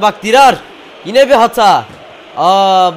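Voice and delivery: loud, frustrated calling out of a player; exasperated yell. Real, not acted. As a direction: shout in dismay at yet another mistake. Reacts to player's mistake in match.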